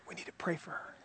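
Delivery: breathy gossip whisper, no voice. Retold or imitated. Friend at lunch whispering a prayer request as gossip. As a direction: hush it like a secret. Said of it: "always in a whisper".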